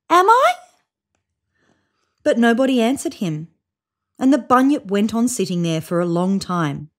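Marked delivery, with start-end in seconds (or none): 0.1-0.5 high, louder hopeful call. A narrator's reading. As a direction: call out hoping for a yes. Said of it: "called the bunyip".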